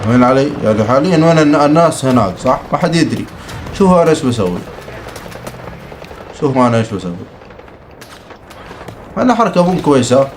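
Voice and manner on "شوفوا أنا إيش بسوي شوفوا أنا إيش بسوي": ordinary delivery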